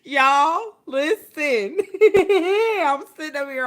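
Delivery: loud, high and breaking into laughter; laughing while telling. Real, not acted. Telling the audience about the giggles, loud laughing delivery. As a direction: laugh through the words.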